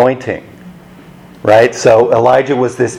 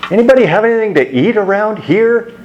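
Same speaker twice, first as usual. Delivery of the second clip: higher, quick, offhand ask for food; casual quoted Jesus; 9 semitones higher, faster than usual. Retold or imitated. Risen Jesus asking his friends for a bite, quick and casual. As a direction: toss it off like a hungry friend.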